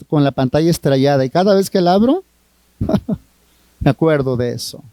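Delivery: normal talking voice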